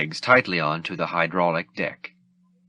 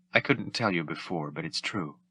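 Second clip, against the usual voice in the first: much softer, tender confession; softly; 3 semitones lower, 8 dB quieter than usual. Acted. Leia tells Han she loves him before freezing, very soft. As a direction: say it softly through tears.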